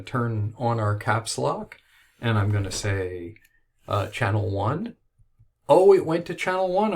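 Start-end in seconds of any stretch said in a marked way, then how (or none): 5.7-6.9 surprised and delighted; bright excited exclamation. Real, not acted. Recording works on first try.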